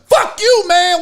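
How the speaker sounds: loud, high hammed-up outburst; high mock-angry shout; mock fury. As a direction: explode at a reporter in pretend rage. Imagined coach snaps at reporter.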